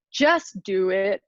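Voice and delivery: slow drawn-out Southern accent imitation; Southern motivational speaker, drawn out. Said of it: "in this really Southern accent".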